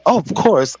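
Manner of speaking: quoting Taquisha in her own voice; Taquisha's voice. Retold or imitated. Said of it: "with her voice, y'all gotta go in that voice".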